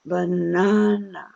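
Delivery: banana, long slow middle vowel; stretched stress. Shown as a demonstration. Teacher leading the class in unison, lengthened stress. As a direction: draw out the stressed syllable of banana. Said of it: "Say it with me".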